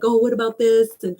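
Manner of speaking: mock worrying. Imitating herself as she starts worrying, mildly anxious. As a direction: voice a mild run of what-ifs. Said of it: "if I start worrying".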